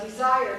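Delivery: DESIRE said loudly with a stretched second syllable; "desire" as a loud dictation word. Shown as a demonstration. Teacher dictating desire to trainees, syllable demo. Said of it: "tell me your syllables".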